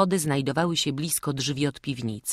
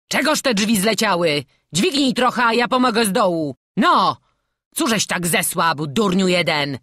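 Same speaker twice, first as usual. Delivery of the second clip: loud, higher-pitched demand; irritated master, full voice; impatient shouting; 6 semitones higher, 8 dB louder than usual. A narrator's reading. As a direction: bawl orders up from the cellar. Robber barks from below.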